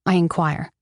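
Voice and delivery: ordinary delivery, nothing marked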